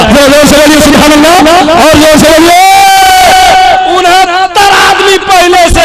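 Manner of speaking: high exhortation climbing to a cry; rousing shout. Real, not acted. Preacher rouses crowd, shouts the number.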